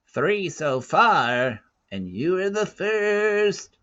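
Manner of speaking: put-on high, slow old-woman voice, fond; tender old woman. A narrator's reading. Kala telling Teo he was her first, slow and high. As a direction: say it slowly like a loving old mother.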